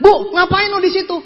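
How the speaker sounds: high, loud challenge to the landlady; startled mock question. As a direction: blurt it out, acting astonished.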